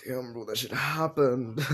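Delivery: slow, drawn-out stunned bro voice; dude voice. Retold or imitated. Boy telling the girl, stunned and slow. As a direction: play it like a dazed guy who can't believe it. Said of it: "He's like".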